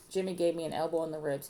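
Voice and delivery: plain reading voice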